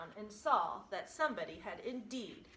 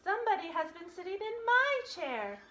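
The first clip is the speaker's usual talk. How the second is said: Mama Bear voice, higher and louder; a mother bear, higher and louder; middle-sized voice; 11 semitones higher, 7 dB louder than usual. A narrator's reading. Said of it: "in her middle-sized voice".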